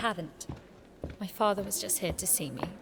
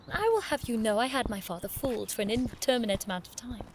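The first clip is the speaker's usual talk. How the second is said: normal talking voice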